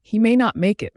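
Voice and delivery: lightly voiced solemn doctor; high, loud stress on not; grave doctor. Doctor delivers grim news.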